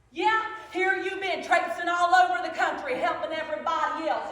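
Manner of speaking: loud, riled-up challenge to God; indignant, loud outburst; hollering angrily. Acted. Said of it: "hollered at him".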